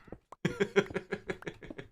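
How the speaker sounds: high airy laugh; breathy laugh. Real, not acted. Streamer laughing at own calming whisper, airy laugh.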